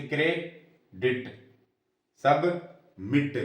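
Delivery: "क्रे, डिट, सब, मिट" with a clear break between syllables; each syllable of "क्रे, डिट, सब, मिट" said on its own; split into syllables. Shown as a demonstration. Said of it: "तो दो syllable है".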